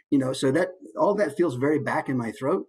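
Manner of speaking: normal talking voice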